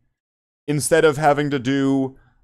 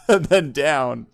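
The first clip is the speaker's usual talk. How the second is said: normal talking voice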